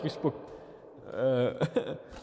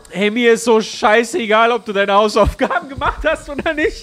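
annoyed outburst; 5 semitones higher, 7 dB louder than usual. Pretend. Snapping back at the child.

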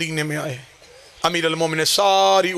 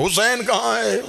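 higher-pitched searching question; searching call; 6 semitones higher than usual. Acted. Companions asking after Hussain, calling out. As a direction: lift the voice as if looking for someone.

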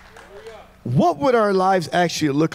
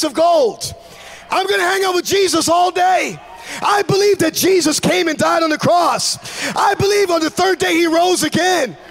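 rising preacher shout; 11 semitones higher than usual. Acted. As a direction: lift the crowd with it. Preaching at full force.

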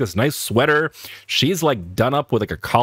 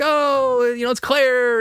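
much higher, slow welcoming call; hearty host greeting; 12 semitones higher, slower than usual. Retold or imitated. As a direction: call out to a friend at the door. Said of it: "and like".

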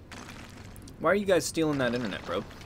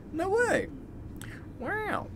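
very high amazed squeal; 4 dB quieter, much slower than usual. Real, not acted. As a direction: gasp in amazement. Amazed reaction.

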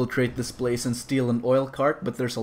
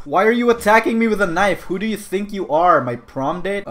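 mock outrage, louder and higher; indignant protest; 5 semitones higher, 4 dB louder than usual. Gamer to his knife-wielding attacker, indignant.

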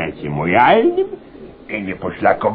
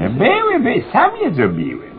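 boastful; 3 dB louder than usual. Acted. Inspector boasts about leaks.

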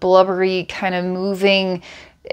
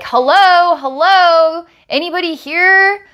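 high, loud, impatient hello to an empty front desk; irritated Karen calling out; 9 semitones higher, 4 dB louder than usual. Retold or imitated. A pissed-off customer calling for staff, high and loud. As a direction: call out high and annoyed, like ringing for service. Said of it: "I'm ringing a bell like".

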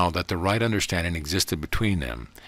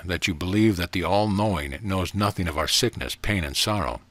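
plain reading voice

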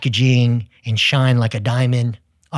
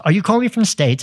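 attempted British accent, much higher pitch; 8 semitones higher, 4 dB louder, faster than usual. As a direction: imitate a British caller, voice lifted high. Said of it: "my attempt at my British accent".

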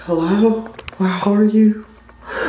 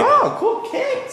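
excited squeal; much slower than usual. Real, not acted. Admiring shoes, squeal.

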